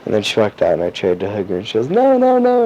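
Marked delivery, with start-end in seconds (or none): 1.9-2.7 very high, louder mimicked squeal; high-pitched mock protest; squealed refusal. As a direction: imitate her playfully fending off a hug. Retold playful refusal of a hug.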